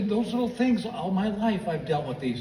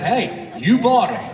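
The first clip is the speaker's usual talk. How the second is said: louder, higher, slow defensive protest; indignant protest; 6 semitones higher, 8 dB louder, slower than usual. Retold or imitated. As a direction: stand your ground, a bit offended. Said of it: "I said".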